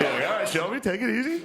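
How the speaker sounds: high, teasing call to calm down; mock exasperated high plea. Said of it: "You're like".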